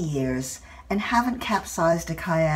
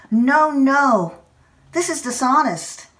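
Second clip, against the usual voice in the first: higher, much louder protest; tearful outburst; 7 semitones higher, 6 dB louder than usual. Retold or imitated. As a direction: blurt it out, refusing your own tears. Tearful outburst at her own tears.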